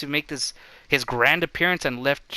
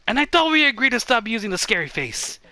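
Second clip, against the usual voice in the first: scolding; 7 semitones higher, 4 dB louder than usual. Acted. Scolding the ghost.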